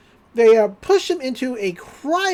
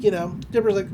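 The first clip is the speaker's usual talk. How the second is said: ordinary talk, nothing marked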